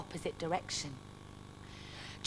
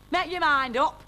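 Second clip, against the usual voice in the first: higher, louder snarl; 6 semitones higher, 6 dB louder than usual. Acted. Grumpy barman at a customer, loud snarl. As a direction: snap it at him, fed up. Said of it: "he snarled".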